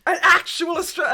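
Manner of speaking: high and loud with delight; thrilled shriek; gasping excitement. Retold or imitated. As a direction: gasp then blurt out in joy.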